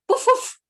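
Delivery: loud, high woofs; dog trying to crow, loud woofs; dog woofing. A narrator's reading. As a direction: bark it out like a dog. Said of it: "The dog gave the woof".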